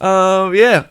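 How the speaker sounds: normal talking voice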